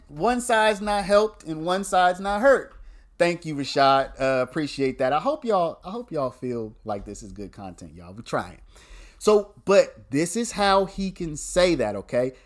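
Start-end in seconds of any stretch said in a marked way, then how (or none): none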